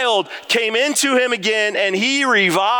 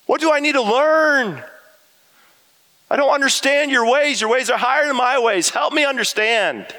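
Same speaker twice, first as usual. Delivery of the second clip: higher, searching plea; honest prayer; 7 semitones higher than usual. Retold or imitated. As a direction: ask God to help you understand, sincere. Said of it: "but I do say this".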